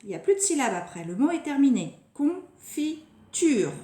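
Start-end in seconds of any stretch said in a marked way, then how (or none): 2.1-3.7 "confiture" slow and higher, in three beats; separated syllables. Shown as a demonstration. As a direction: break "confiture" into con, fi, ture. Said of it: "La dernière syllabe".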